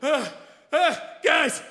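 out of breath, high shouting; panting shout. Acted. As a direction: gasp it out, then yell. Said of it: "collapses, panting".

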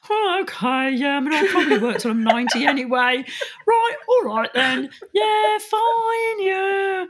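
high, loud breezy shrug of a voice; mock resigned Brit. [name] mocking herself as a resigned Brit, breezy and high. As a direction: play the too-polite Brit cheerfully accepting anything. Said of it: "I'm like".

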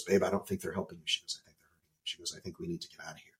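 soft, hushed wife's warning, nearly whispered; quietly voiced worried wife; hushed urging. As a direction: lean in and murmur the warning like a secret.

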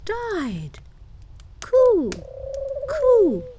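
high-pitched bird voice; wood pigeons. A narrator's reading. Pigeons tell Gerda about little Kai, high birdlike voice. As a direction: talk high like a cooing pigeon. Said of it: "said the wood pigeons".

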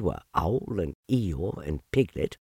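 ordinary delivery

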